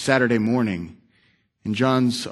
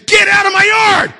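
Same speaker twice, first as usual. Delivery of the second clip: very high, very loud yell; enraged man shouting the old man off his lawn; furious shout; 9 dB louder than usual. Retold or imitated. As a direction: charge at him and roar it. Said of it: "he comes right at this guy and says".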